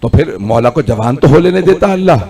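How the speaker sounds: ordinary delivery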